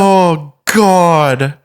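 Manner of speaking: real horror. Real, not acted. Listener reacting to the shooting story, horrified. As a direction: recoil at the gruesome thought.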